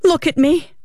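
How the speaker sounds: high, pointed command; sharp. A narrator's reading. Wife demanding her husband's attention, sharp and high. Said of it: "she said sharply".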